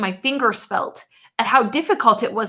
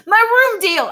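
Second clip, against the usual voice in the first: panicked shout; 11 semitones higher, 6 dB louder than usual. A narrator's reading. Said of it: "I shouted".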